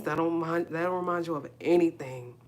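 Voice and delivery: normal talking voice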